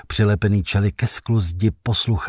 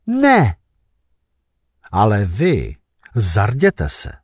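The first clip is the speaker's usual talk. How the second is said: rising, rousing official proclamation; proclamation read-out; 3 semitones higher than usual. Acted. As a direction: read it like a state decree to the crowd. Narrator reads the State Gazette proclamation. (slavnostní vyhlášení státního listu)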